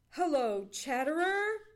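loud, hearty call of hello; cried greeting. A narrator's reading. Johnny greeting the squirrel, cried out loudly. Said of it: "cried Johnny".

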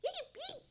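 high little yips; high-pitched puppy bark; yipping dog. A narrator's reading. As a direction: yip like a small dog. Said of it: "bark his head off".

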